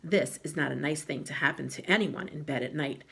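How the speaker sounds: usual speaking voice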